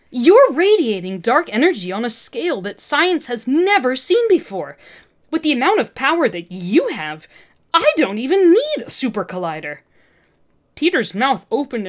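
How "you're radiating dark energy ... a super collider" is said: loud, higher, manic villain; feral excitement. Acted. Olivia raving at Peter about his power, manic. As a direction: gush with crazed scientific glee. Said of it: "her eyes flaring with feral excitement".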